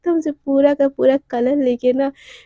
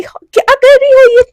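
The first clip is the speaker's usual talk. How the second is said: high, loud outraged cry after a soaking; shocked yell; 8 semitones higher, 9 dB louder than usual. Acted. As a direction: scream it like you were just dumped in cold water. Dunked into water bucket. (भीगने पर चौंककर ऊँची ज़ोरदार चीख)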